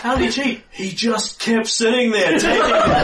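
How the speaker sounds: high, loud incredulous argument; protesting onlooker. Acted. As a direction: object like a bystander in a heated debate. Crowd argues over alleged cheating.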